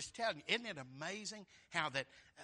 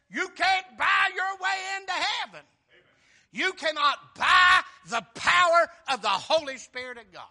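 much higher and shouted; full-force preaching; 12 semitones higher, 19 dB louder than usual. Real, not acted. Preacher thundering to the congregation that salvation cannot be bought. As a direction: preach it at the top of your voice.

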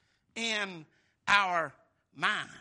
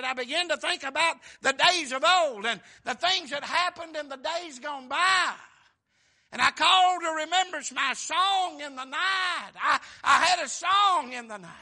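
loud, higher shouted preaching; full-force preaching; 5 semitones higher, 11 dB louder than usual. Acted. Preacher shouting the psalmist's memories to the congregation. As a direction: belt it out like a revival.

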